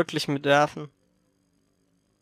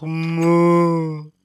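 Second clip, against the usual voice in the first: cow moo; 2 semitones lower than usual. Retold or imitated. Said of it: "die Kuh macht dann einfach".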